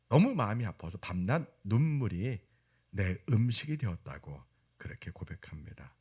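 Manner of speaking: ordinary talk, nothing marked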